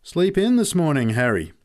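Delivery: chipping a latecomer, pitched high and loud; high, loud, teasing call. A narrator's reading. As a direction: needle a mate for turning up late. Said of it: "He chipped the blokes coming in late".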